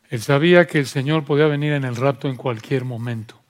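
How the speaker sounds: usual speaking voice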